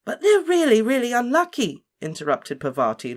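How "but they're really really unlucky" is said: put-on much higher, louder, anxious girl; alarmed. A narrator's reading. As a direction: blurt the warning in alarm. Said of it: "looking alarmed".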